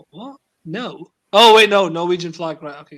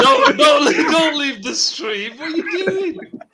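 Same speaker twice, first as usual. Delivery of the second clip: loud, high plea through laughter; real amused, drawn-out protest; laughing protest; 7 semitones higher, 6 dB louder, much slower than usual. Real, not acted. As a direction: shout through your laughter. Pleading with friend not to leave.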